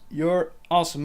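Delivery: ordinary talk, nothing marked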